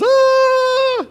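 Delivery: high, loud mock scream of a frantic mind; panicked scream. Preacher acting out the anxious half of the mind, screaming. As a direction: let out a wild panicked "Ah!". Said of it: "One is".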